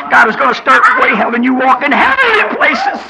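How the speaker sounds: high, loud, slow hollering sermon cry; full-force preaching. Acted. Preacher crying out to the congregation about riches. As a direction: lift them up, crying it out.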